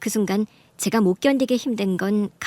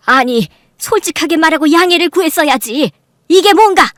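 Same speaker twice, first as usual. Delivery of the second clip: loud, rising to a high, shouted finish; stern reproach; 6 semitones higher, 10 dB louder than usual. Acted. Mother scolding her cheating son-in-law, stern. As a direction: berate him, building to a shout.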